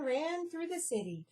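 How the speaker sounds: ordinary delivery, nothing marked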